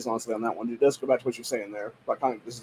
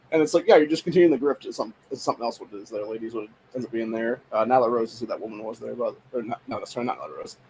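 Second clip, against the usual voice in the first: ordinary talk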